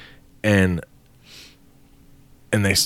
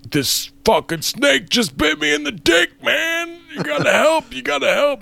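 high frantic yelling for help; hammed-up high-pitched panic; panicked yell. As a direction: scream it like you just got bitten. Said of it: "he yells to his buddy".

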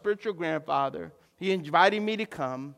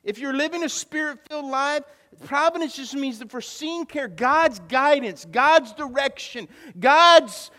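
high, loud, full-force sermon delivery; impassioned preaching; 8 semitones higher, 10 dB louder than usual. Real, not acted. Pastor declares belief in providence to church, impassioned preaching. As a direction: preach it out hard, punching key words.

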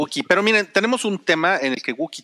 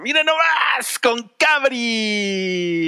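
higher, long drawn-out hype call; announcer shout; 9 semitones higher, much slower than usual. Pretend. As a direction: present him like a ring announcer. Introducing the next speaker. (grito de presentador)

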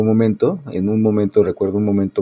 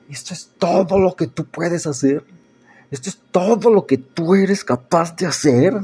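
higher-pitched, half-acted reproach; retold challenge to God; 10 semitones higher than usual. Preacher retelling his angry words to God, mock reproach. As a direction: reenact hurling blame at God, raised pitch. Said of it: "y yo le decía".

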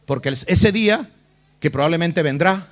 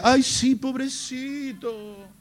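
high, drawn-out cooing, fading away; mock pity; 6 semitones higher, 5 dB quieter, much slower than usual. Preacher parodies God pitying the believer, mock cooing. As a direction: coo in exaggerated sympathy, stretching the last word.

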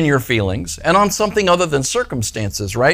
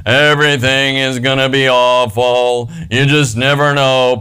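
low, slow, mopey donkey drone; sighing Eeyore impression, slow and low; 3 semitones lower than usual. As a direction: drag every word like a gloomy donkey. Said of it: "Eeyore talked like this".

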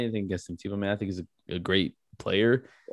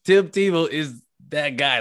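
ordinary talk

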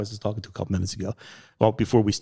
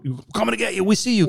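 high mocking cop taunt; high-pitched mock cops calling into the swamp; 11 semitones higher, faster than usual. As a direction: taunt them like a bluffing cop.

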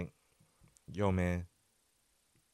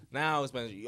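ordinary talk

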